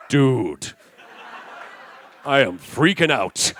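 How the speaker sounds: loud, slow, hammed-up hero voice; a cartoon hero freaking out, stretched out; Yami. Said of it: "He's like".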